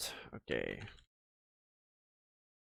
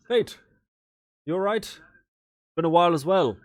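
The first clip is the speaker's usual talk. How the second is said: higher, louder friendly call across the room; 6 semitones higher, 5 dB louder than usual. Acted. Father calling out to Fate across the tower. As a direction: hail an old friend warmly and loud.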